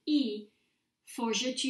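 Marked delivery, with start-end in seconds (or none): none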